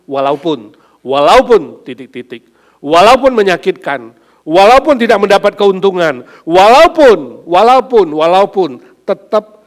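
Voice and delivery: loud, rising, slowing on walaupun; preaching at full force. Acted. Pastor crying out about agape love to the congregation, at full force. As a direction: hammer walaupun again and again.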